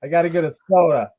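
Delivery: loud amateur Boston on "soda"; Boston accent. Pretend. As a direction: ham up a poor Boston accent. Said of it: "I'm not good at that".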